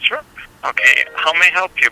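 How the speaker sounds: ordinary talk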